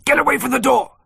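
high, loud shouted order; urgent shout. A narrator's reading. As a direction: shout them away from danger. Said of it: "I shouted then".

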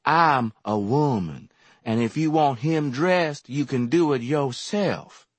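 slow, sassy drawl; lazy sulky drawl. A narrator's reading. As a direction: take your time, she won't be hurried. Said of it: "she drawled".

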